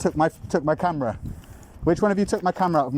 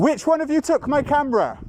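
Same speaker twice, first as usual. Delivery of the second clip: raised, insistent calls, higher pitch; yelling across the room; 8 semitones higher than usual. Real, not acted. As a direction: call out demanding across the room. Keeps demanding across room.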